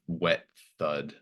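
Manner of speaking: normal talking voice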